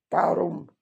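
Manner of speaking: loud one-word retort; curt robber. A narrator's reading. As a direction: answer bluntly as the bandit.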